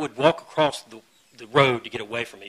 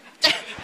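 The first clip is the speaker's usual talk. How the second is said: breathy laugh; 3 dB louder than usual. Real, not acted. Laughs at own reaction.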